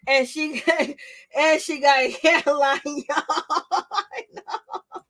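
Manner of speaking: words breaking up into laughter; helpless laughing delivery. Real, not acted. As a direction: crack up while trying to talk. Cracking up over the hairline.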